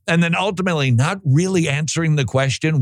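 ordinary talk, nothing marked

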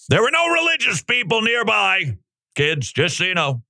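high, lightly voiced teacher talking to kids; mock teacher. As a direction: play a sugary teacher glossing over facts. Mocking a sanitized history lesson.

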